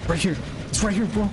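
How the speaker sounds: high-pitched urgent warning; alarmed. Real, not acted. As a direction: squeak it out as danger closes in. Killer is right beside them.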